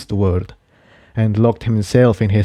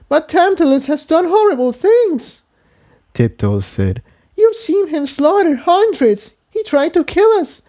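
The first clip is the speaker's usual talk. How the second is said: much higher, upset arguing; higher filly voice, distressed; Tiptoe, upset. Acted. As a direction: argue back, shaken. Tiptoe argues back.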